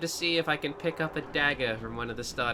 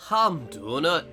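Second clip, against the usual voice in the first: bad Scottish accent; 2 semitones higher, 4 dB louder, slower than usual. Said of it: "bad Scottish and bad Irish".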